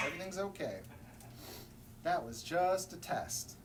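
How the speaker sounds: plain reading voice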